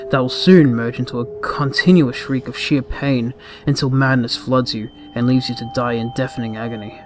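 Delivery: ordinary delivery, nothing marked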